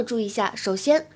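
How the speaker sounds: ordinary talk, nothing marked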